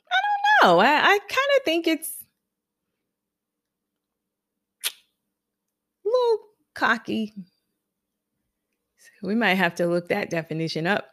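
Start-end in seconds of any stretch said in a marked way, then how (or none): 0.1-7.3 hesitant friend. Retold or imitated. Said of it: "And she was like".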